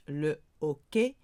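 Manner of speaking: le hockey with no H sound, said oh-key; teacher modelling le hockey without the H; silent H. Shown as a demonstration. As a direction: drop the H in hockey, start straight on the vowel. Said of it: "don't pronounce your H".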